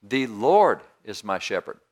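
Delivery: slow line leaning heavily on "Lord"; stress on LORD. Shown as a demonstration. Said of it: "Let's change it".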